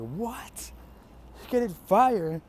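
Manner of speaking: high, loud incredulous question; shocked disbelief. Acted. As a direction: blurt it out as a stunned crowd. Everybody reacts to fire eating.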